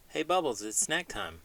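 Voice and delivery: ordinary delivery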